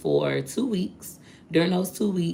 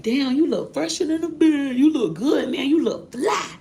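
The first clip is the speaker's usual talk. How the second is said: very high, loud admiring hype; loud excited praise; crowd cheering; 10 semitones higher, 5 dB louder than usual. Retold or imitated. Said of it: "Everybody like".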